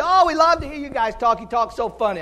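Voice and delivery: high, gushing imitation of admiring listeners; hammed-up fan of the accent; mock gushing. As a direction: gush in delight, starting high and loud. Mimics gushing New England churchgoers.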